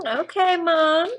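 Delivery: high-pitched put-on kid's reply; mock teenage son. Co-host as the son answering his mother, mock teenage son. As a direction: play the punished kid answering back, drawn up high.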